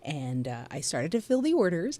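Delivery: ordinary talk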